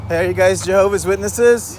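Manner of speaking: loud, high call across the street. Real, not acted. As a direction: call out to them from afar. Calling out to passersby.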